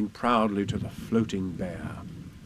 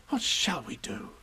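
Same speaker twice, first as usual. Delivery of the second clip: whisper; 5 dB quieter than usual. Acted. Despairing aside to Pooh.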